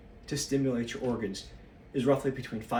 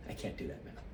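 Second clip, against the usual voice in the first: \"I can't do that math\" whispered to himself, lower and much softer; a whispered repeat under the breath; whispered aside; 7 semitones lower, 10 dB quieter than usual. Real, not acted.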